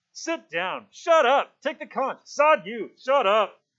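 high, loud volley of heckles; shouting. A narrator's reading. The storm breaks over Simon in the assembly, shouting. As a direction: yell each order over the crowd. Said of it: "Ralph shouted".

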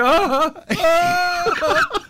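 high, sharp bursts of laughter; imitated weird laugh. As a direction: cackle in short high yelps. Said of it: "he was laughing so weird".

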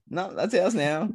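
high, smug and dismissive; mock a greedy rival club. Mocking rival club's voice.

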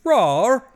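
high, loud mock monster roar; British-accented roar. Imitating Rawhead Rex for laughs, British-accented roar. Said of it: "a British accent too".

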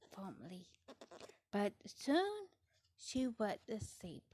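plain reading voice

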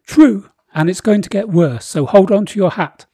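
booming royal decree; pompous king voice. Acted. Boris again.

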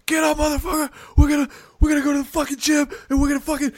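high-pitched acted wake-up yelling; frantic hammed-up screaming, pitch way up; mock screaming. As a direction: scream it like you're rousing a sleeping roommate. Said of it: "waking up screaming in his roommate's ear".

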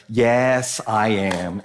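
low, drawn-out, unapologetic yes; deadpan admission. Acted. Dad answering critics of his TV parenting, deadpan and low. Mock reply to judging parents.

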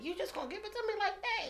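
fast, very high-pitched question; squeaky mock-amazed query; retold disbelief. Asking therapist about weeks off work, retold disbelief.

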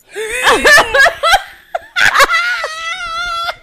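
loud high howling laugh; shrieking laugh. Real, not acted. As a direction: scream with laughter. Big laugh.

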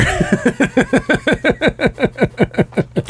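high, rapid staccato cackle; long giggling laugh. Real, not acted. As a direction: laugh on and on at your own joke. Laughs after own joke.

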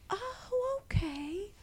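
put-on high, soft, quavering sweet old lady; old Southern lady. Old lady greeting the inspector, high and soft. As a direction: play the sweetest little old Southern woman.

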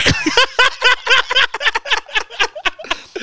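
a squealing laugh that falls away; high burst of laughter. Real, not acted. Laughing at his own croaky impression, high squealing laugh. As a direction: crack up, high and breathless.

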